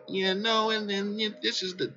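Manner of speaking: a hint of a nerdy chart reader; mock chart guy. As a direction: lightly imitate a bland analyst explaining trend lines. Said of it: "they're kinda like".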